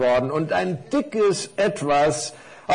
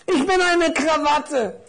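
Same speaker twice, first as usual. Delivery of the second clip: very high, squeaky little character; little parcel; 12 semitones higher, 4 dB louder than usual. Acted. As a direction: announce yourself in a high little voice. Little parcel says what it is. (hohe Stimme eines kleinen Päckchens)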